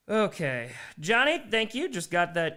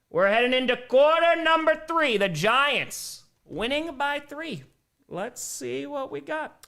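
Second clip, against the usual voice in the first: announcer voice; 10 semitones higher, slower than usual. Acted. Announcer style.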